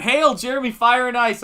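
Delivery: high, loud hearty hail; over-the-top friendly shout; booming greeting. Retold or imitated. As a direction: belt out the greeting like a big buddy. Friend bursting into the room.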